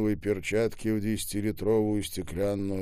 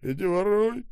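high, raised yell; shouting; 12 semitones higher, 5 dB louder than usual. A narrator's reading. Скоба before dropping the machine gun, shouting. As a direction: call it out loud and high.

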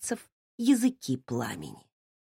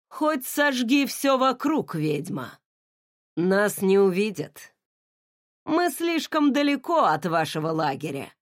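gloating mockery. A narrator's reading. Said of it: "откровенно потешался, мерзко посмеиваясь".

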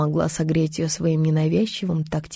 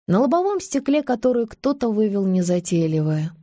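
ordinary delivery

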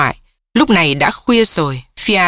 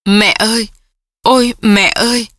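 strange half-sob; 3 semitones higher than usual. A narrator's reading. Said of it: "Cái âm thanh kỳ lạ, không ra tiếng thở dài, không ra tiếng nức nở".

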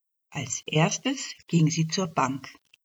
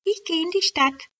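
called out; 12 semitones higher than usual. Acted. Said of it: "rief sie".

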